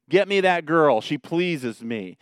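loud, high demand, slowing at the end; acted-out bossy son ordering his parents; disrespectful young buck. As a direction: demand it like a brash young man. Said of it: "without respecting or being accountable to his parents".

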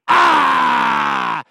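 loud, high demonic shriek; demon's scream. Acted. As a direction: let out a piercing demon yell. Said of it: "He goes".

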